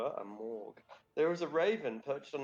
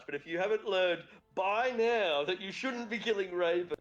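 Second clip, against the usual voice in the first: raised pitch, hammed-up indignation; mock scolding; 6 semitones higher, 4 dB louder than usual. Game master speaks for the curse punishing raven killers, mock scolding. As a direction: lay down the law in mock outrage.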